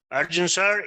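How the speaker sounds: ordinary talk, nothing marked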